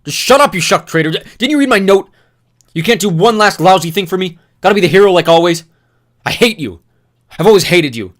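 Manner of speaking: loud, high furious yelling; raging shout. Acted. Newt screaming at Thomas, raging loud shout. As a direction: spit the words out in a rage. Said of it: "his words shot out in a rage".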